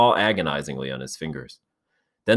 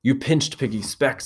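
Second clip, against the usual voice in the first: breathless; 7 semitones higher than usual. Acted. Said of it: "said Ralph breathlessly".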